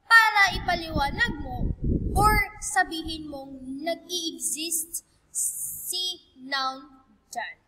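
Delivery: ordinary talk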